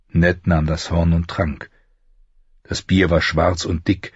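ordinary delivery, nothing marked

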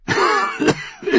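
rasping hacking cough; wet coughing fit. A narrator's reading. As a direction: cough hard, chest rattling. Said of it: "ehe der Husten seine Worte verschlang".

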